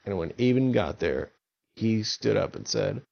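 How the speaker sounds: usual speaking voice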